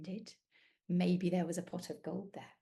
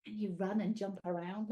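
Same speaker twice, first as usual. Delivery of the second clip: ordinary delivery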